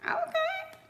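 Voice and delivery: very high, drawn-out impressed okay; impressed ooh okay. Retold or imitated. Reacting to his generosity, very high and impressed. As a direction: sound pleasantly shocked. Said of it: "I was like".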